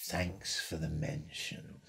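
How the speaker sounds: low, slow, soft croak; unhurried laid-back rock-star murmur. Retold or imitated. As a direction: drawl it very slowly and low. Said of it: "like that".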